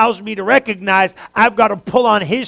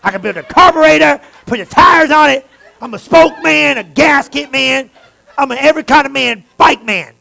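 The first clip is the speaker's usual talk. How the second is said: loud, high cocky bragging; mock macho bike guy shouting; jerky know-it-all; 5 semitones higher, 8 dB louder than usual. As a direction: brag like you can fix it all alone. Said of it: "you get that jerky attitude".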